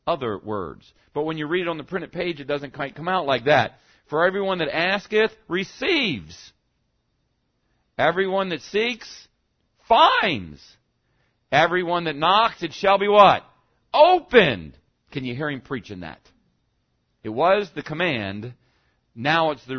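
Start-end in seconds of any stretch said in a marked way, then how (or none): 4.1-14.8 receives, finds, opened punched high and loud; results stressed. Shown as a demonstration. Preacher showing how Jesus preached it, stressing results. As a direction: lean hard on the result words. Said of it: "the emphasis was on what? Other words".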